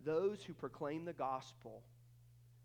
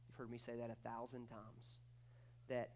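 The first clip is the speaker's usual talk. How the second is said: ordinary talk, nothing marked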